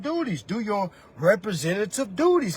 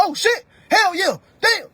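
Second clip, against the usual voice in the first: excited; 8 semitones higher, 5 dB louder than usual. Retold or imitated. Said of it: "and you like".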